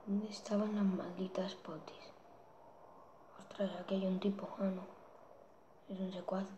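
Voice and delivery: hushed whisper while sneaking. Real, not acted. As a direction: whisper so the enemy won't hear. Sneaking near an enemy.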